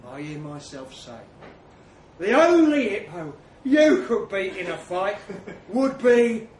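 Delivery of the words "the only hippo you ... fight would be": loud, deliberate insult with pauses; loud taunt. Acted. Narrator dropping the bombshell insult on Trev, taunting. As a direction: deliver it loudly in separate chunks. Drops the insult that starts fight.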